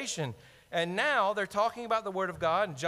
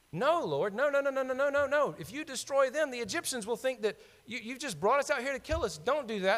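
high, hurried pleading no no no; pleading Moses; 7 semitones higher than usual. Retold or imitated. Moses begging the Lord not to destroy the people, high pleading. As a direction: beg the Lord to stop, voice up high.

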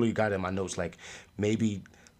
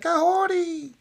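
loud, very high mock call of a name; hammed-up high-pitched name shout; imitating the character; 9 dB louder, slower than usual. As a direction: belt it out like a cartoon cry. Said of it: "He was like".

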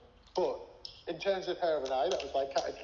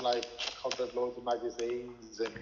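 usual speaking voice

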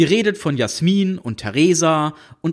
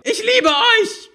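high, slow loveless scream; high teen girl yelling "I love you" sarcastically; 3 dB louder, slower than usual. A narrator's reading. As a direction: bellow it with no love at all. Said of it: "mit herzlich wenig Liebe in der Stimme".

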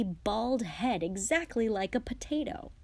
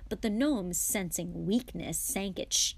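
ordinary delivery

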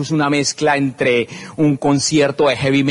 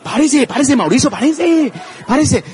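urgent urging; 9 semitones higher than usual. Retold or imitated. Urging his brother-in-law to stand, high and insistent. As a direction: hurry him up, over and over. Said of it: "yo le decía".

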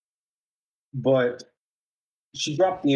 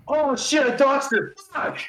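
shocked panic; 12 semitones higher than usual. Retold or imitated. Roommate realizing he ditched his own girlfriend's car, panicked. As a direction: yelp it out in sudden dismay.

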